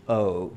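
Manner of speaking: O said as an English glide, oh; O gliding like English "oh". Shown as a demonstration. Teacher demoing the gringo O. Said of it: "cambiar todas las O por".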